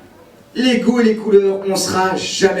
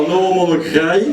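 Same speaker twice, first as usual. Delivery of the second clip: thick mock Carolo accent on English lyrics; 2 semitones lower than usual. Comic mocks a Carolo singer covering [name], thick Carolo accent. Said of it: "il perd pas son accent".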